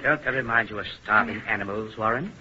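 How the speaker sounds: low, soft, cold observation; hushed clinical remark; detached aside. Acted. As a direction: murmur it aside to an assistant. Captor comments aside to assistant.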